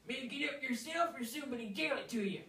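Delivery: lightly voiced backwoods codger, amused; old woodsman chuckling. Rancid laughing off the request, in folksy voice. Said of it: "he said".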